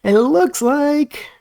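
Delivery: imitating the AI voice. Vlogger mimicking the chatbot weighing its answer, slow and high. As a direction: mimic the chatbot pausing to think. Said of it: "like, thinking, like".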